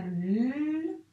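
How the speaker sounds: "l" held long, slowly; drawn-out teacher's "l"; isolated l sound. Shown as a demonstration. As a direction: sustain "l" alone. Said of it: "il y a deux sons".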